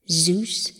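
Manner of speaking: ordinary delivery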